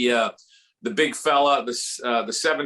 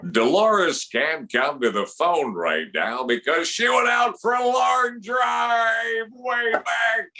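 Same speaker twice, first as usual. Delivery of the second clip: slow, drawn-out home-run call; announcer building to a high, stretched shout; Harry Kalas; 4 semitones higher, slower than usual. Acted. Phillies announcer recording an outgoing message, soaring home-run call. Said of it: "the outgoing message on your cell phone".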